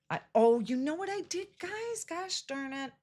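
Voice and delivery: rising squeak of realization fading out; sudden dismay. Real, not acted. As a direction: catch your own mistake mid-sentence. Realizes she made a mistake.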